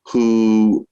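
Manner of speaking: ordinary delivery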